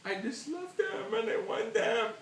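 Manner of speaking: high, overwrought wail of love; tearful gushing. Pretend. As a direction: wail it as if overcome with adoration. Gushing tearfully about loving beer.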